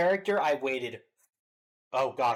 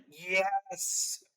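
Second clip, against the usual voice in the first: drawn-out "yes!" of delight, higher; excited cheer; 5 semitones higher than usual. Retold or imitated. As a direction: cheer a long, happy yes. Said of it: "I was just like".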